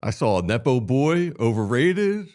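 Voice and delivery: ordinary talk, nothing marked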